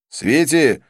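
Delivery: short loud hail; calling out. A narrator's reading. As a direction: call the waiter over. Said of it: "кликнул я официанта".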